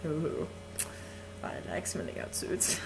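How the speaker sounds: sly whisper. Acted. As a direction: murmur it with a wink. Lecherous whispered aside.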